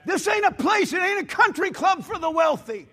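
loud, high, shouting preacher; full-force preaching. Acted. Pastor rebuking a church that shuts out the poor, shouting. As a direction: thunder it at the congregation.

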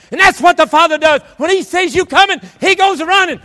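high, loud pulpit shout; full-force preaching. Real, not acted. Preacher to congregation on raising children, full-force preaching. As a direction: drive the point home at full preaching volume.

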